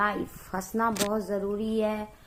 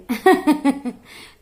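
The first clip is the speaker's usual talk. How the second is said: bright burst of higher-pitched laughter; real laugh; 6 semitones higher, 12 dB louder than usual. Real, not acted. Vlogger joking to viewers about hunger and kitchen, laughing. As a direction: laugh out loud, pitch dropping at the end.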